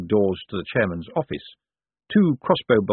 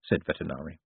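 ordinary delivery, nothing marked

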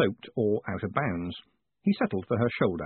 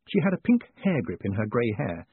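plain reading voice

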